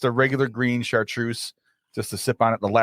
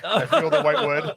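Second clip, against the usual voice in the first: laughing mock quote; 4 semitones higher than usual. Quoting friend's line mockingly.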